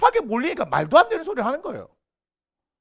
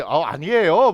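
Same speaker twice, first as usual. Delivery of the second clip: lower-pitched denial in a put-on Seoul accent; mock Seoul accent; 7 semitones lower, slower than usual. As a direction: Gyeongsang native passing as a Seoulite, Seoul-accent lilt rising at the end. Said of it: "그 사투리가 있어요".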